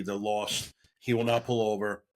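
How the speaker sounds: ordinary delivery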